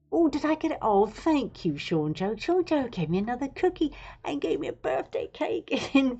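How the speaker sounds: giddy thanks breaking into a laugh; delighted. Real, not acted. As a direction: gush over the gift. Thrilled at a chat gift.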